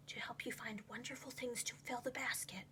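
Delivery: secretive whisper to his son. A narrator's reading. Dad takes Clayton aside with a secret tip, whispering. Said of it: "he whispered".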